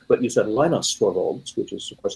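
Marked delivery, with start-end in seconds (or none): 0.5-1.6 "Linus" said LINE-us; long i in "Linus", as in English; English-style Linus. Shown as a demonstration. Guest giving the less pedantic form, LINE-us for "Linus". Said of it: "how you usually say that in English".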